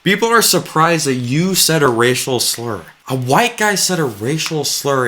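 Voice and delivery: mock-incredulous, stress on are; sarcastic disbelief. Real, not acted. Sarcastic restating of guest's complaint.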